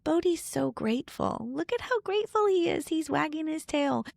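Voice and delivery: high, sing-song praise for the dog; bright, high voice talking up the dog's gratitude; cooing to the kids. Retold or imitated. Mother to her kids at feeding time, high excited cooing. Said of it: "I'll be like".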